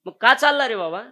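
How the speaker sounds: high, loud, pressing question from the conductor; calling out as the conductor. Acted. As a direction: call it out across the bus. Conductor questions the runaway boy.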